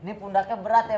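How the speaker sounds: loud. Real, not acted. Caller can't hear well.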